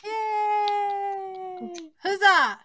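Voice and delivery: happy high yay; cheering. Real, not acted. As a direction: cheer him on. Cheering a Patreon supporter.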